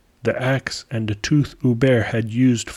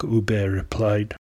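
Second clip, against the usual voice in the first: ordinary delivery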